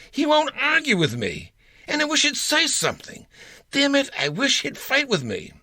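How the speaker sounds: imitating a young woman. Young woman complaining to her counselor, high mock voice. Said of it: "As one young woman recently told me".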